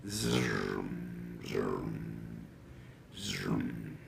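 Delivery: low buzzing plane noise; airplane zoom. A narrator's reading. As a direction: drone like a plane. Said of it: "It went".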